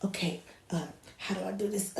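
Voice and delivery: quiet, hesitant fumbling; softly flustered, halting; nervous. Retold or imitated. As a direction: imitate an unsure baby holder. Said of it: "somebody that's nervous".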